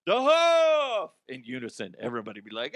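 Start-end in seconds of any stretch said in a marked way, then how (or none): 0.1-1.1 shouted greeting, much higher and louder; crowd calling out. Retold or imitated. Inmates greeting Norm in unison, shouting. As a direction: cheer his name like a whole bar. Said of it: "they'd be like".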